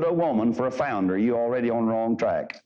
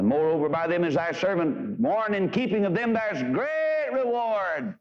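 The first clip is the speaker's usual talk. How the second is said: scripture reading; 6 semitones higher than usual. Acted. Quoting scripture in raised preaching voice.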